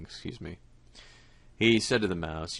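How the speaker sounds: plain reading voice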